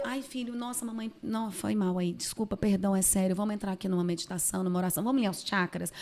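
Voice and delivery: soft, low apology, picking up speed; desculpa depois de estourar. Retold or imitated. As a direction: back down quietly and make amends with your child. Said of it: "E quando eu estouro, eu". (pedido de desculpa baixinho ao filho)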